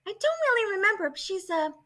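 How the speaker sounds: high, cute cosplayer voice; lightly voiced bubbly girl; Samugi voice. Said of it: "she's kinda like this".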